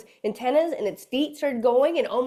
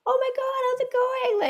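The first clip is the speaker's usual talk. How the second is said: high squealed hello to a pet; delighted greeting; 13 semitones higher than usual. Retold or imitated. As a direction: gush over a little animal. Greeting excited bug.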